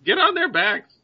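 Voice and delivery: high-pitched bird order; bird leader's command. Acted. Bird leader insists adventurers mount up, high creature voice.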